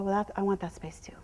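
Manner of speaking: hushed, unvoiced claim to the space; whispered claim. Shown as a demonstration. Trainer showing how she claims the space, whispered. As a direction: whisper it as the herd leader. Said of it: "tell her".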